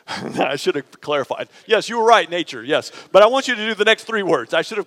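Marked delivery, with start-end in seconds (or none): none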